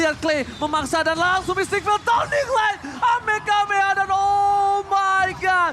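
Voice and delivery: high, loud, drawn-out hype call; fighting game announcer. Acted. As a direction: announce the comeback win at full hype. Caster calls the game-winning play.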